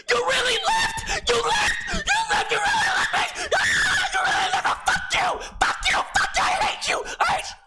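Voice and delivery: high, wailing shrieking imitation of her; hysterical screaming. Girl rages on FaceTime at being left, imitated screaming. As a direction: shriek it in a hammed-up meltdown.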